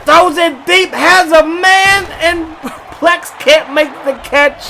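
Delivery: live play-by-play climbing in pitch; excited call. Real, not acted. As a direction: build the hype as the ball flies. Live call of deep pass.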